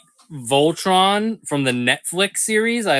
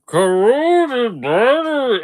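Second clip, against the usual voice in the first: slow, drawn-out character voice; guess-who voice, dragged out slow; Star Wars character voice; 9 semitones higher, 5 dB louder, slower than usual. Acted. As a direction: stretch the words like a sci-fi alien. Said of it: "I've done a Bane impression before".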